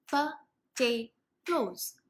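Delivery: pa-tay-toes said very slowly, one syllable at a time; split into syllables. Shown as a demonstration. Parent at dinner showing a child how to break up the word. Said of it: "So I go".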